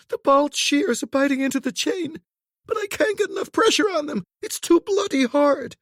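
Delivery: high, strained panicked voice; frantic distress. A narrator's reading. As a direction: near tears with effort. Woman straining, failing to cut chain.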